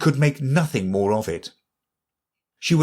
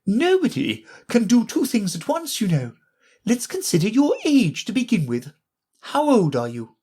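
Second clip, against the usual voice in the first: high fussy Queen voice; Queen quizzing Alice; brisk Queen; 11 semitones higher than usual. A narrator's reading.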